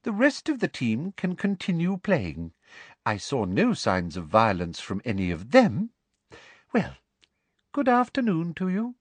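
high, sugary official handing out punishment; Umbridge, smugly triumphant. A narrator's reading. Narrator voices gloating villain Umbridge.

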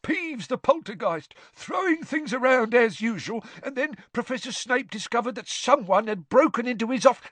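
high-pitched, loud tattling voice; Filch. A narrator's reading. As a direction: gabble an eager complaint in a high whine.

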